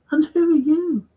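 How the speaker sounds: high-pitched mock snob voice; snobbish surgeon. Snobbish surgeon addressing the man's companion, mock-snooty and high. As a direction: put on a high, snooty question.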